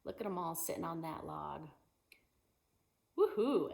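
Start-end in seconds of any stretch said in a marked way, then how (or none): 3.2-3.7 excited woohoo. Acted. Reading picture book to kids.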